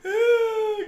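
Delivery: high, loud, helpless laughing; crying with laughter. Real, not acted. Losing it over a photo, high crying laughter. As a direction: laugh till it hurts.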